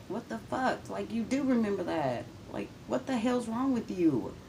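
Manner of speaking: high, exasperated disbelief; can't believe she denies it; incredulous. Retold or imitated. Daughter railing at her mother, high exasperated disbelief.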